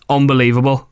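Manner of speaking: normal talking voice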